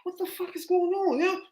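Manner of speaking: high, disbelieving exclamation; incredulous outburst. Real, not acted. To his friends about the cops, high incredulous exclamation.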